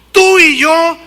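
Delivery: loud rebuke, high and sharp on Tú y yo; rebuking shout. Retold or imitated. Thief on the cross shouting at the other thief, loud rebuke. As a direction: snap back at the other thief. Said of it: "le gritaba".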